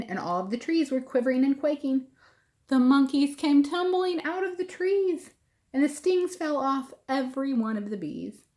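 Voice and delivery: ordinary delivery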